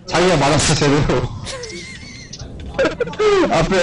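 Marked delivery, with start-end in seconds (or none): 1.3-3.5 high burst of laughter. Real, not acted. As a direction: laugh hard at the blunder. Laughs at teammate's mistake.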